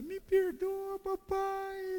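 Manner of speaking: very high, wailing plea for forgiveness; crying child. Retold or imitated. Davi begging his father while crying, high wail. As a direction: beg like a sobbing little boy. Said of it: "já começou a chorar".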